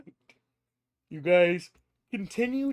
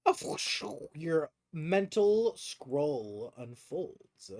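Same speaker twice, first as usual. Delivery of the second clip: slow, hushed scene setting; game master narration; 4 dB quieter, slower than usual. A narrator's reading. Game master reveals a new potential ally, slow narration. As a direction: unfold it slowly and softly for the players.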